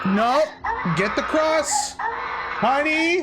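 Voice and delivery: frantic high-pitched shouting; panicked shout. Acted. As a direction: scream for the cross in a panic. Acting panicked parent scene.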